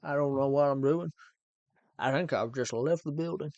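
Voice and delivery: [name]. Acted. Streamer riffing for viewers, loose Elvis impersonation. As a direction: ham it up as a not-quite Elvis. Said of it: "Sound like [name]".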